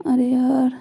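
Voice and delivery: usual speaking voice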